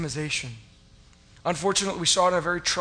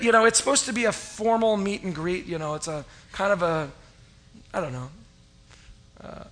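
ordinary talk, nothing marked

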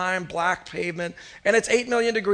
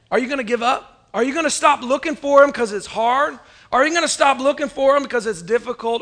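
higher, louder rhetorical challenge; preaching challenge; 4 semitones higher, 4 dB louder than usual. Acted. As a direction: press the crowd hard. Challenging congregation.

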